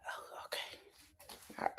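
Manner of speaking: breathy whispered "oh, okay", drawn out slowly; hushed aside. Real, not acted. Host reacts to the video rumor in a slow whisper. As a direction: let it slip out under your breath, slowly.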